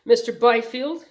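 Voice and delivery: usual speaking voice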